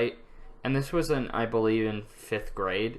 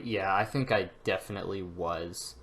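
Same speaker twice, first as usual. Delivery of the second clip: normal talking voice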